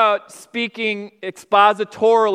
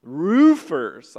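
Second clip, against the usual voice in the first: mock Georgia drawl, oo stretched out and gliding; Georgia accent; 4 semitones lower than usual. Pastor mimicking his secretary from Georgia, drawn-out Georgia accent. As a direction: imitate a Georgia accent, stretching the vowel into two syllables. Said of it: "with, with two syllables".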